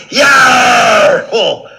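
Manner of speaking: high, very loud yell; overjoyed holler; exuberant shout. Acted. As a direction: explode at full volume. Shout at peak of thanks.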